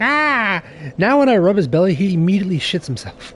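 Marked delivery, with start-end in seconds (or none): 0.0-0.6 a sharp high startled yelp for the cat; imitating a frightened cat, higher; mock cat yelp. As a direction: squeal like a spooked cat. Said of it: "He's like".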